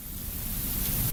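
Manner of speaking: sudden breathy laugh bursting out; can't hold the laugh in; snorting laugh breaking through; burst of laughter. Real, not acted. Try-not-to-laugh, bursts out.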